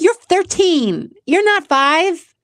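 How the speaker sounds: imitating her own fed-up reply to a picky eater; exasperated mom. Mom retelling scolding her thirteen-year-old son, mock exasperated.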